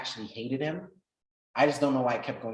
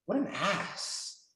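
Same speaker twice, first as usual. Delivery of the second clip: slow exasperated jab; 7 semitones higher, much slower than usual. Real, not acted. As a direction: drag it out, fed up. Annoyed at mentor walking away.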